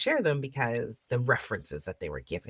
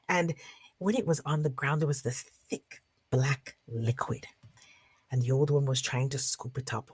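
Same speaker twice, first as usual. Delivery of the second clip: lively storyteller narration; story narration; 4 semitones higher than usual. Acted. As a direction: tell it to a circle of listeners. Storyteller narrates folk tale.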